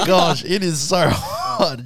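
laughing through it, drawn out; can't stop laughing at the heat. Real, not acted. Telling friends about her cousin, laughing high and slow.

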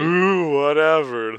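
loud, high mock-impressed jeer; teasing mockery. Host giving his co-host crap over metallurgy, mock-impressed teasing. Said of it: "I'm just giving you crap".

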